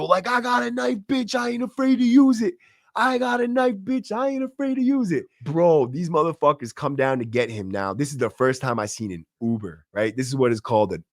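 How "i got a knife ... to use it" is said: high-pitched strained mock scream; crazed high squeal, no real volume; imitated screaming threat. Imitating inmate at window threatening cops, mock screaming. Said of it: "and scream, tell the cops".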